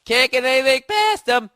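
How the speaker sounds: loud mocking repeat. Real, not acted. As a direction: hammer the jab home. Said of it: "My voice just cracked".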